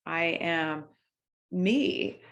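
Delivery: ordinary delivery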